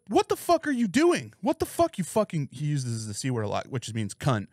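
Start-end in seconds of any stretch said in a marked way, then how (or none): 0.1-2.5 put-on homeless girl's outrage. Retelling the soaked girl yelling at him, high indignant cry. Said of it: "she's like".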